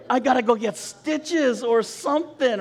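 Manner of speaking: high, exasperated complaint; mock-mad griping with a comic edge; retold anger. Injured man dreads needing stitches, exasperated retold anger. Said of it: "I'm even more mad now".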